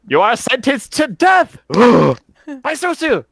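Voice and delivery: high, hammed-up sentencing, with a gasp; mock sentencing. GM mock-sentencing the players to death. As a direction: pronounce doom with a mock gasp.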